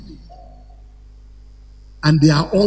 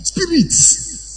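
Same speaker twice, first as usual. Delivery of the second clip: ordinary delivery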